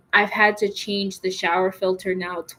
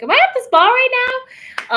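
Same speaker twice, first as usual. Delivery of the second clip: very high, playful squeal; dreamy spa voice; 8 dB louder than usual. Retold or imitated. Enjoying the hose-like shower at home, squealing very high. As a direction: swoon like you're at a spa. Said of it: "So I'm like".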